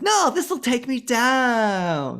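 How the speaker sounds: high, very slow campy diva whine; hammed-up diva. Beef fussily refusing drugs from the manager. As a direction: stretch it out like a fussy rock diva.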